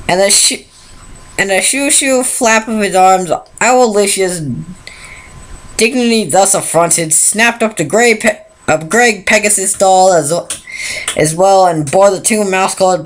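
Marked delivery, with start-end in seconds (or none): none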